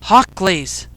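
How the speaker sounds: understated shout of realisation; mild yell. A narrator's reading. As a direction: call it out as the idea strikes. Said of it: "Ken yelled".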